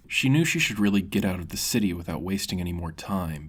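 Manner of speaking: ordinary delivery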